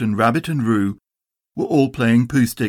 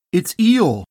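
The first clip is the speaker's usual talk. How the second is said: Pooh's voice, moderately raised in pitch; mild surprised Pooh; 6 semitones higher than usual. A narrator's reading. Pooh realises it is Eeyore.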